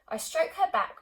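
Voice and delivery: ordinary delivery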